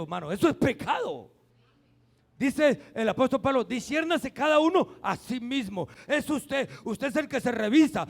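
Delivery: fiery pulpit preaching; preaching at full force. Acted. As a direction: hammer the point home to the congregation. Preaching on Holy Communion.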